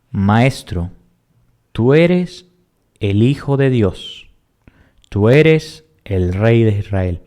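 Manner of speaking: slow, weighty reading of Natanael's cry; solemn slow proclamation. Acted. Teacher reading Natanael's exclamation to the class, slow and solemn.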